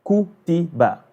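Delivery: Ku, ti, ba repeated with short vowels; short syllables. Shown as a demonstration. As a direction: keep each of Ku, ti, ba brief. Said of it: "I just say ku".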